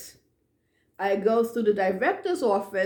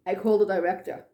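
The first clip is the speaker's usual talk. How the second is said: ordinary delivery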